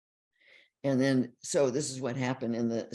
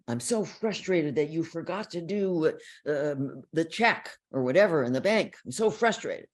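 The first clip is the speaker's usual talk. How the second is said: louder, higher mock complaint about the forgotten check; frustrated complaint; 4 semitones higher, 6 dB louder than usual. Partner venting frustration at the other, mock-frustrated and louder. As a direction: play the partner venting blame.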